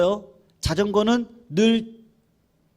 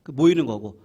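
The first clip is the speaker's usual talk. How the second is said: normal talking voice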